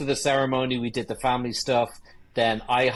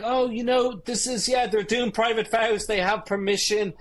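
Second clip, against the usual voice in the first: higher, lightly mock polite reply; own reply retold; 9 semitones higher than usual. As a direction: sweetly explain yourself in a raised voice. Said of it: "And I'm like".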